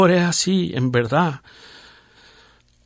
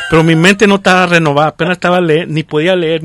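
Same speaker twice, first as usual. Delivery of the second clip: emphatic loud; 9 dB louder than usual. Real, not acted. Stressing his unrenewed mind.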